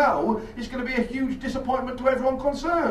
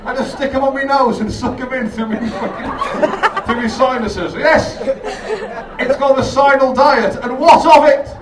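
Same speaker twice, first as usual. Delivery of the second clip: louder, cocky and defiant; mock defiance; 3 semitones higher, 9 dB louder than usual. Comic boasting about his sinal diet, loud and defiant. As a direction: brag it like it's perfectly normal.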